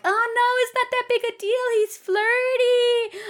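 put-on high, loud, dismissive girly voice; mock naive woman.

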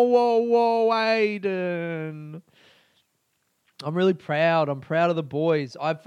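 normal talking voice

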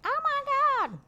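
very high mock shriek; high squeal. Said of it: "I'll just be like".

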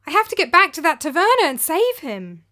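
loud, high, alarmed pleading; urgent cry. A narrator's reading. Demos waking in fright, urgently telling Aristo. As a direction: blurt it out in a rush of panic. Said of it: "I cried".

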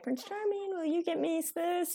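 high, soft coaxing voice; playfully put-on pleading lilt; joking wheedle. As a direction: sweet-talk a husband into a favor. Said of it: "I'll jokingly call him".

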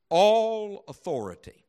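high, long-held "all"; pause, then ring out "all"; stress on ALL. Shown as a demonstration. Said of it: "I'll emphasize it as I read it".

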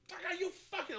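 high, strained mock fury at the ref; a coach sputtering with rage under his breath; red-faced coach curse. Tom Coughlin fuming on the sideline, high strained curse. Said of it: "all red in the face".

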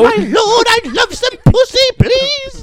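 high, loud mock-medieval begging; pleading peasant. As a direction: beg your lord like a peasant. Begs lord in mock medieval voice.

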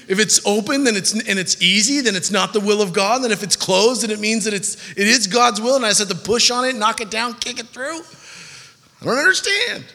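high, rambling mock bewilderment; acted baffled protest, pitch rising to the end; exasperated confusion. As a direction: pile it up, climbing to a high-pitched wail. Confused listener piles up contradictions.

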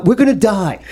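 high, laughing doom; mock terror through laughter; laughing dread. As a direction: laugh it out as if you're done for. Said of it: "looking at each other like".

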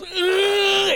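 high, shouted mock Wookiee howl; Wookiee roar. Host imitating Chewie's roar at the death, high and shouted. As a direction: let out a Chewie roar of grief. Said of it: "Chewie goes".

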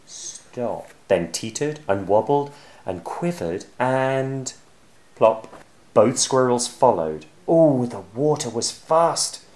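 ordinary delivery

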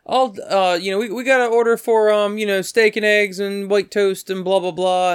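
imitated waiter calling an order. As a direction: rattle off an order to the kitchen. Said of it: "he's like, um".

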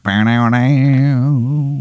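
slow, sung guitar riff; mock guitar riff. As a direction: imitate a twangy guitar lick. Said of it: "a guitar riff be like".

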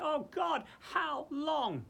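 high, loud pleading lament; anguished cry. Pretend. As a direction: lift the voice in an aching plea. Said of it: "We should be looking around and say".

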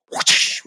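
sharp whooshing swat noise; imitated towel swat. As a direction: make the whoosh of a towel snap.

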